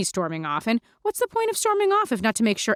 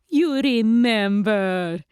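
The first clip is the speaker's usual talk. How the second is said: put-on vampire Lulu, accented; much slower than usual. Lulu asks Mandy why she skipped dinner, accented and puzzled. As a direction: ask like a doting old-world vampire.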